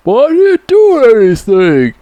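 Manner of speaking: high, whining and loud; whiny high Rambo. Retold or imitated. Rambo pleads with cops, high whiny voice. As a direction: imitate Rambo pleading like a kid. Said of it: "Stallone's character's like".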